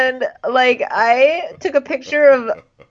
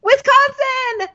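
excited reveal; 13 semitones higher, slower than usual. Pretend. Excited announcement.

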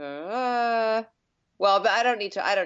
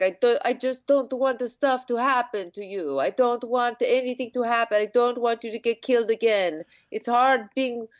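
higher-pitched, hammed-up brotherly plea; pleading brother; 5 semitones higher than usual. Brother to crying sister who was killed, mock pleading.

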